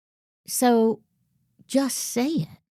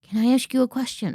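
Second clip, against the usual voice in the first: quick, tentative "Can I ask you a question?"; timid asking; 5 semitones higher, faster than usual. Shown as a demonstration. Coach mimics a meek employee asking, quick and tentative. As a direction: rush the permission-seeking meekly. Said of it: "The sentence that starts with".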